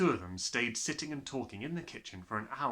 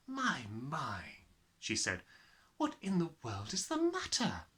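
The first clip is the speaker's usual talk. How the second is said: Miss Honey; 3 semitones higher than usual. Acted.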